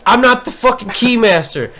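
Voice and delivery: mock-indignant retort; retold angry retort. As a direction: throw it back at him, fed up. Retold cursing at coworker.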